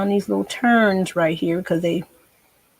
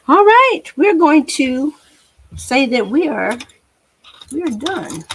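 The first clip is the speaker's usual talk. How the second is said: high, loud cheerful declaration; excited announcement; 6 semitones higher, 6 dB louder than usual. Real, not acted. Painter telling viewers the piece is done, high loud cheer. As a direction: proclaim it loudly to the viewers.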